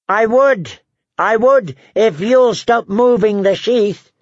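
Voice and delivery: high-pitched indignant retort; squawking little jouster; exasperated comic dwarf. A narrator's reading. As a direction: call it back high, slowing on the punchline.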